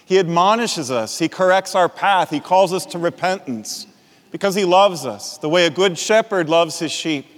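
normal talking voice

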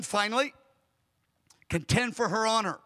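ordinary talk

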